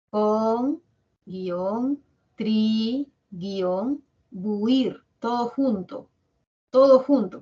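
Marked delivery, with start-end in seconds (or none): 0.1-5.0 Con, tri, buir said one by one with pauses; teacher dictating contribuir in syllables; spelling out syllables. Shown as a demonstration. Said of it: "¿Cómo separamos contribuir?". 6.7-7.4 louder, higher insistence on Todo junto; teacher correcting loudly; firm loud. Real, not acted. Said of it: "Profesora, pero usted dice".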